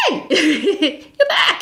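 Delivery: high, laughing hello; bright greeting. Retold or imitated. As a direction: greet him with a delighted laugh. Greeting the returning client.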